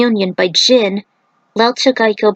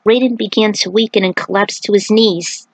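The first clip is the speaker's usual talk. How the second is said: ordinary delivery, nothing marked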